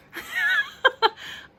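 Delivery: high, loud cackle of laughter; real cracking-up laugh; big laugh. Real, not acted. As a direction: burst out laughing at the joke. Reacting to sarcastic card.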